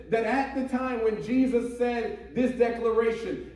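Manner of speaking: ordinary talk, nothing marked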